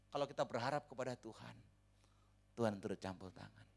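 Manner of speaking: ordinary delivery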